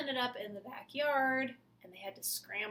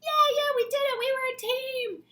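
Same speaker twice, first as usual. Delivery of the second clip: cheering; 13 dB louder than usual. Acted. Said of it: "Henrietta said".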